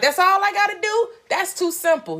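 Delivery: high, squeaky disbelief; high mock disbelief from a sceptical listener; mock incredulous listener. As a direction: play the doubter who can't believe it's that easy. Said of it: "You're like".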